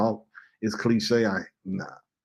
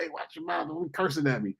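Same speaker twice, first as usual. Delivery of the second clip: soft, higher mock scolding; mocking prissy scold; 6 semitones higher, 7 dB quieter than usual. People scolding him over cursing, a soft mock scold. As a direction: parody a prim scold, pitched up and hushed. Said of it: "people are like".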